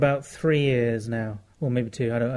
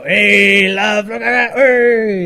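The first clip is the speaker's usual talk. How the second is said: louder, higher sing-song hey love; mock sing-song call; 5 semitones higher, 9 dB louder, much slower than usual. Imagining teasing a woman in the street, a loud sing-song call. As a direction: sing it out cheekily across the street. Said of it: "run up ahead and go".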